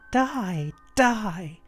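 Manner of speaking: high but restrained cry; retold shouting. A narrator's reading. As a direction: act out a shout without full volume.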